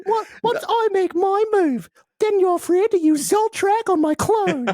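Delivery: very high put-on character voice; mock anime character. Host parodying the show's battle plan, mock anime character. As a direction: declaim it in a squeaky, hammed-up pitch. Acts out a line from the show.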